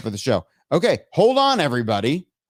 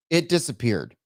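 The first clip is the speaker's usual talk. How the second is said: ordinary delivery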